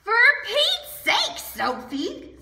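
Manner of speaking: fed up with the noise; annoyed brother. Acted. Brother Willie annoyed while fishing.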